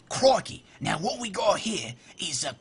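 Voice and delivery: a playful Australian wildlife presenter, creeping up on a crab; Australian accent. Pretend. Gamer friends asked for Australian, mock Australian wildlife host. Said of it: "Somebody said do Australian".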